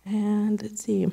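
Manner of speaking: a breathy whisper to herself while finding her place; whispered aside. Real, not acted. Speaker whispers to herself mid-testimony, checking notes. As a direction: drop to a whisper, thinking aloud.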